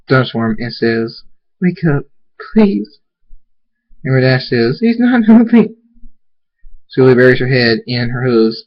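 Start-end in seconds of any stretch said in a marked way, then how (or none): 1.6-3.0 high plea, rising on "please"; anxious, distressed entreaty; desperate pleading. Acted. Pleads with unconscious family member. 4.8-5.8 high, loud alarm; frantic, raised voice; panicked. Acted. Panic over motionless friend.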